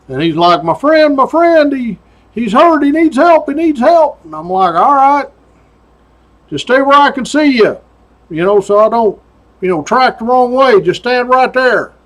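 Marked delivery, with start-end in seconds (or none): 0.6-4.2 high, urgent pleading; panicked plea. Retold or imitated. Man on the mountain begging the trooper, high and panicked. 4.7-11.9 raised voice calling up the slope. Retold or imitated. Trooper calling uphill to the man, keep him in sight.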